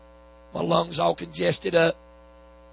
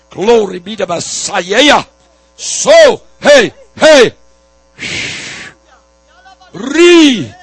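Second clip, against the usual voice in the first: builds to shouted cries, higher, louder; fiery preaching; 4 semitones higher, 12 dB louder than usual. Real, not acted. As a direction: preach at full force, climbing to ecstatic cries.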